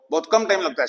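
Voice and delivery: ordinary delivery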